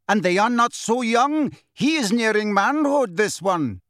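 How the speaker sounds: high, forceful objection; arguing centaur. A narrator's reading. Centaur holding Harry, arguing with Ronan.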